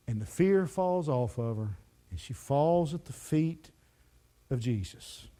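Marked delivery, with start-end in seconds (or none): none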